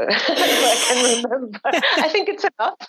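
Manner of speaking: breaking into high-pitched laughter while talking; laughing. Real, not acted. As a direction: say it through rising laughter. Laughs at her own memory running out.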